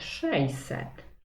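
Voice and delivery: "sześćset" reduced to szejset; most simplified pronunciation. Shown as a demonstration. Teacher showing pupils the shortest correct form, "sześćset" as szejset. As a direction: say "sześćset" with a y-glide replacing ść: szejset. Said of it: "jeszcze bardziej możemy uprościć".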